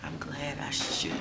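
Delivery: hushed, breathy whisper in a quiet salon; real whisper. Real, not acted. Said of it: "it's kinda quiet in here".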